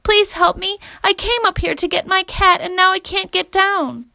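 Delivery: put-on high little-girl voice; frightened child begging for rescue; small girl pleading. A narrator's reading. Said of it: "he saw a small girl".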